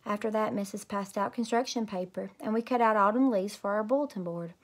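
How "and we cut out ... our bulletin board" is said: ordinary delivery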